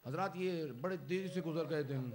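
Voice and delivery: normal talking voice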